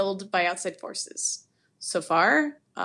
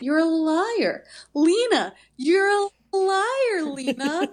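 high, loud, stretched-out accusing wail; drawn-out accusation; 7 semitones higher, 6 dB louder, slower than usual. Retold or imitated. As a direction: imitate an over-dramatic accusing delivery, dragging the key word. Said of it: "she says it kind of like that".

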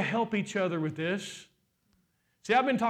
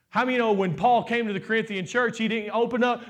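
full-force preaching; 5 semitones higher, 5 dB louder than usual. Acted. Pastor preaching to the congregation, full force. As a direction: preach it hard to the church, drawing each word out.

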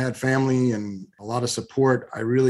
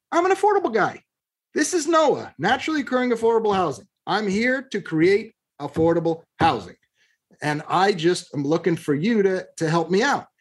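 retold insistent pitch; 6 semitones higher than usual. Retold argument with county official.

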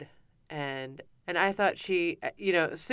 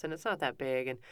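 ordinary talk

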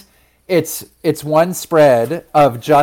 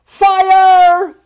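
very high, loud, long-held scream; piercing panicked shout; 10 dB louder than usual. A narrator's reading. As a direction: scream the alarm, stretching the word. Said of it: "the ambassadors screamed".

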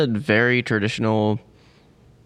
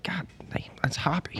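whisper; 6 semitones higher, 11 dB quieter than usual. Retold or imitated. Quoting own reaction to beer.

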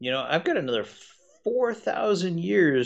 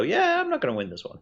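much higher, fast, shrugging dragon; a clever dragon sizing them up; sensible old dragon; 12 semitones higher, faster than usual. Retold or imitated. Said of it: "size this group up fairly quickly and go".

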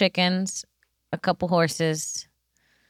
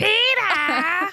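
mock cry; 11 semitones higher than usual. Crying out for help.

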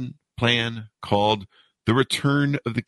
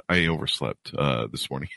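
ordinary talk

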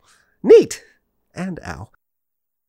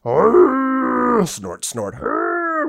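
mock rhino snorts; 11 semitones higher, much slower than usual. Host doing rhino sounds for his ride. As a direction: ham up a rhino. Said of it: "rhino sounds".

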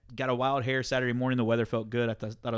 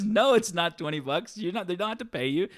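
higher-pitched imitation of a protesting mother; retold motherly objection; 5 semitones higher than usual. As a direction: correct your kid in a retold scene. Mother retold correcting her son.

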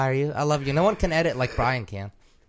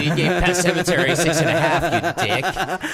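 raised, louder joking indignation; mock outrage; 6 semitones higher, 5 dB louder than usual. Host scolds friend over prank rating, mock outrage. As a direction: pretend to curse out a friend, half laughing. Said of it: "'Cause I'm like".

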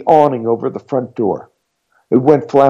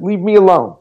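fast, much higher, louder shout; nervous man shouting at the stranger; 9 semitones higher, 3 dB louder than usual. A narrator's reading. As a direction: fire it at her through the door. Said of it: "he shouted".

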